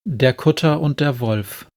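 plain reading voice

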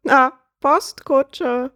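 high, very slow bird voice; relished punchline. Acted. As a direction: stretch the repeat out, savouring the joke.

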